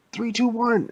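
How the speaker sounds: high-pitched police countdown; urgent countdown. Acted. Police about to storm the store, high urgent countdown.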